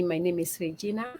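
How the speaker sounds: ordinary delivery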